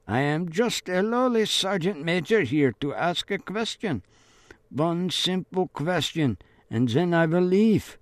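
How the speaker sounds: lightly voiced wheedling sergeant; coaxing soldier. A narrator's reading. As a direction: wheedle through the gate. Soldier coaxes through gate.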